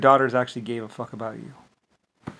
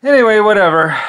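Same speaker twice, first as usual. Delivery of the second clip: loud; 9 semitones higher, 14 dB louder than usual. Real, not acted. Angry outburst.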